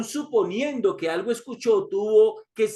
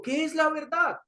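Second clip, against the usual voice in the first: higher, lightly voiced Pilato wondering; Pilato's searching question; 9 semitones higher than usual. Pilato asks Jesus about truth, higher and searching. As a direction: ask it up high as a puzzled governor.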